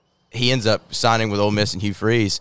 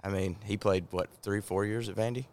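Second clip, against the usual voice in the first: off mic; 11 dB quieter than usual. Real, not acted. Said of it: "Get as close to that thing as you can for me".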